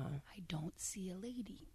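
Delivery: slow, soft unvoiced whisper. Real, not acted. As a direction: hush it like a secret. Gossip whispered aside.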